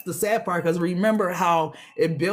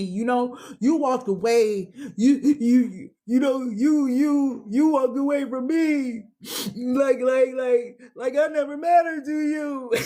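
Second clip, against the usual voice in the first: higher, near tears, stammering "you, you"; hurt plea; 5 semitones higher than usual. Acted. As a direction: cry out at someone who walked away. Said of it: "I have real tears finna come out my eyes".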